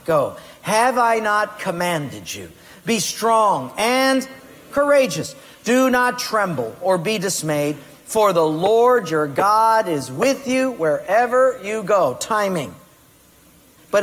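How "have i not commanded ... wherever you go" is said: scripture read aloud. Shown as a demonstration. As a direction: proclaim the verse, rising to a loud courageous. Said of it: "Read it with me".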